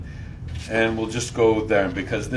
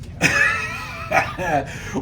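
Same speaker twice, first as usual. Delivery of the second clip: shocked yelp. Acted. Moses sees golden calf.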